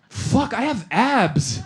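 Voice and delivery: high, drawn-out comic wail; slow, strained high cry; mock cry-laughing. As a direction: imitate being helpless with laughter. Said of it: "I started cry laughing like a lunatic".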